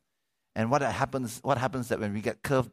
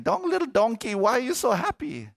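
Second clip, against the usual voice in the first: much higher, squeaky animal voices; curious barn animals; 13 semitones higher, 4 dB louder than usual. Retold or imitated. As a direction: ask like little farm animals. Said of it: "all the other animals are saying".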